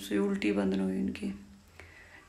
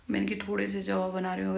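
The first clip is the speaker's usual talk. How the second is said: ordinary talk, nothing marked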